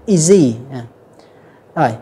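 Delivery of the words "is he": "Is he" run together as i-ZEE; "Is he" said as one word, izzy; linked, H dropped. Shown as a demonstration. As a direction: link the Z of "Is" onto "he", no H. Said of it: "nối cái âm giờ ở phía trước".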